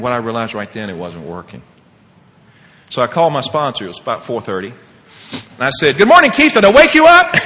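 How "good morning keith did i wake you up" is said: high, loud, over-eager phone greeting; agitated greeting. Retold or imitated. Caller ringing his sponsor at four thirty, agitated and loud. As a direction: re-enact a worked-up early-morning call. Frantic early call to sponsor.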